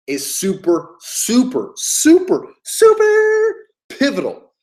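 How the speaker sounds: ramp super up step by step to a peak; building emphasis. Real, not acted. Coach stresses his key point, climbing to a high peak.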